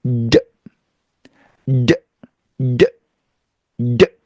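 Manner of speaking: 드 as a bare d sound; isolated d. Shown as a demonstration. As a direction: say 드 as a lone d. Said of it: "이렇게요".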